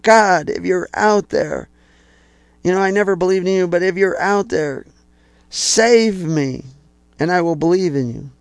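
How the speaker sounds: high, pleading cry for help; desperate plea. Acted. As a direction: beg God from the cliff, high and strained. Man clinging to cliff calls out.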